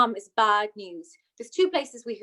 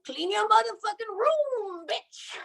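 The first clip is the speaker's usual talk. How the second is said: much higher, brash hype man yell; rapper hype man; 10 semitones higher, slower than usual. Retold or imitated. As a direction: echo it like a nineties hype man. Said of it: "I'll go".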